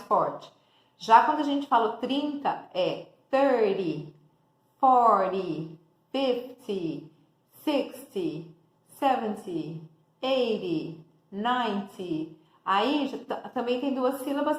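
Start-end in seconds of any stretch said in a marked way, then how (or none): 3.3-12.4 "thirty" with "thir" stressed and a short "ty"; stress first syllable. Shown as a demonstration. Teacher shows students the strong first syllable of "thirty". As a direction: hit "thir" in "thirty" hard. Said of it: "a primeira é a tônica, a primeira é mais forte".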